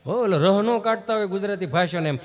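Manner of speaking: ordinary delivery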